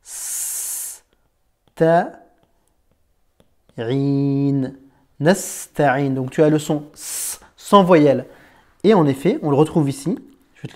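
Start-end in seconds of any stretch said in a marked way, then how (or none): 0.0-1.0 voiceless hissed s on its own; a long bare s; isolated s sound. Shown as a demonstration. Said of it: "tu dis na". 1.8-6.1 na, a bare hissed s, ta, rin, slowly apart; slow sound-by-sound nasta'in. Shown as a demonstration. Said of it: "tu dis na".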